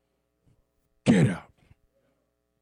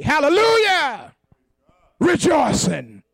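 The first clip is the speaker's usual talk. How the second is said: ordinary talk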